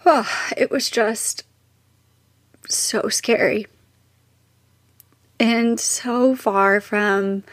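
choking up, slow and halting; tearful. Real, not acted. Mother recalling her son's traumatic birth, tearful. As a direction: voice thickening with real tears. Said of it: "I'm getting emotional".

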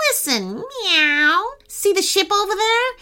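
put-on high, light cat girl voice; cat answering the boy, in a high voice. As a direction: talk like a perky cat. Cat Lola's voice.

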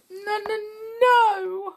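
high, tearful no; weeping. A narrator's reading. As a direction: wail the noes through tears. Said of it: "wept the cla".

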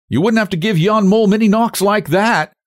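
high-pitched broad teasing. A narrator's reading. Young man teasing as he rubs his arm. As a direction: tease her, voice held right up. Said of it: "he teased".

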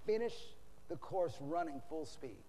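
very soft, earnest counsel; hushed last words. Retold or imitated. Dying apostle charges his pupil, hushed and sinking. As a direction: say it softly, fading at the end.

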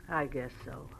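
resigned sigh. Acted. Bessie gives in, defeated.

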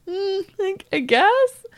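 high-pitched, hesitant concession; doubtful reaction. Retold or imitated. Recalling a reaction to surprised coworkers, doubtful. Voices own sceptical reaction to people.

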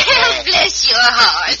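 high laugh, then slow, laughing greeting; light up at the sight of old friends; old aunt laughing as she greets visitors; delighted laugh. Acted. Old woman greets returning friends.

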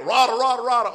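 loud high shouted rah rah; imitated raging gibberish. Imitating the gamer's raging gibberish yelling. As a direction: bark out rah rah like a cartoon monster. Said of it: "All you can do is rah, rah, rah".

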